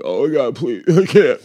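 imitated exhausted parent breaking down, half laughing; hammed-up tearful begging; mock crying. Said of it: "you start to cry as an adult".

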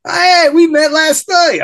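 loud, high, drawn-out mock greeting; teasing call-out. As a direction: call him out across the classroom, teasing. Cop calling him out in class.

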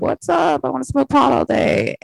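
put-on a happy-go-lucky teenage stoner. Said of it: "and I was like".

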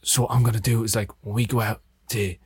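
lightly put-on Australian film-star voice; Russell Crowe's voice. Star pulling a crewmate aside, lightly imitated Australian voice. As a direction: imitate a blokey Australian actor, understated. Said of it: "Stop doing the voice".

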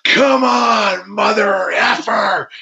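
high, slow, defiant yell at opponent; defiant shout. Retold or imitated. Wrestler retelling taunting his opponent, defiant shout. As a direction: roar a challenge back. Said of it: "I went".

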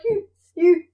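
teary-eyed monk sobbing his excuse; crying. Acted. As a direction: blubber it out, hurt and confused. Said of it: "Ocho starts to cry".

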